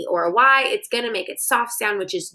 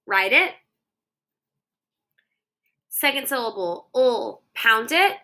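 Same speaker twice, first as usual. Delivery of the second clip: ordinary delivery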